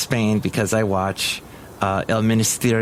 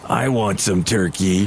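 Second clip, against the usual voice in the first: dog voice; 5 semitones lower than usual. Retold or imitated. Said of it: "Basil is grunting, going".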